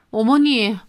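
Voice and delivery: ordinary delivery, nothing marked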